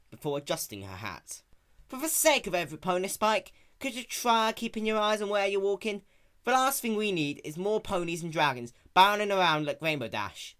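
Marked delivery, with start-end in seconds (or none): 1.9-10.6 higher, twangy exasperated farm mare; annoyed Applejack. Acted. Applejack scolding Spike for the stick, exasperated. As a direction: scold him for not looking. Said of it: "Applejack rubbed her head again".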